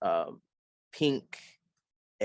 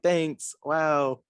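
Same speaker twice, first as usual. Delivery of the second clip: higher-pitched, mildly awed reply; retold starstruck thanks; 7 semitones higher, 4 dB louder than usual. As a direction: replay a flattered little thank-you. Retelling own starstruck reply to band.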